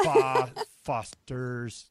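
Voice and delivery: slow, careful Fo-, Foster's in mock Australian; imitating an Aussie accent. Host proudly tries the Aussie word again. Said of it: "Got it".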